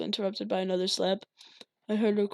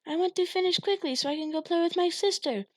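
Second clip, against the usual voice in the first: put-on high, light little boy voice; lightly voiced young boy; child voice; 9 semitones higher than usual. A narrator's reading. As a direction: voice the eager kid.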